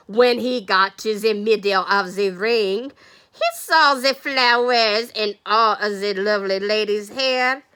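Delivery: put-on storytelling in a hammy Spanish-turned-French accent; botched Spanish accent. Teacher narrates the bullring scene, badly faked Spanish accent. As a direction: attempt Spanish, let it slip into French. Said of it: "That's coming across as French".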